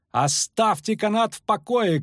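high, slow calling out; shouted plea. A narrator's reading. Poet begs them to leave the rope, high shout. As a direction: call out high, stretching the words. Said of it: "крикнул Лютик".

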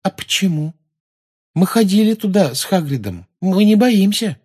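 high, loud pupil's protest; protesting schoolgirl. Acted. Pupil assuring the teacher they are unafraid, high and insistent.

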